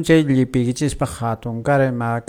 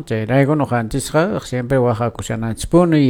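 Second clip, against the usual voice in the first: normal talking voice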